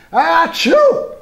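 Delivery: a huge high explosive achoo; big sneeze. Acted. Reading to children, the bear's enormous sneeze.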